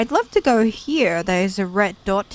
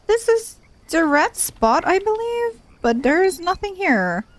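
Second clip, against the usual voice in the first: puzzled; 11 semitones higher than usual. Real, not acted. Confused player searching map.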